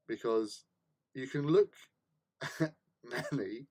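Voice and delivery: ordinary talk, nothing marked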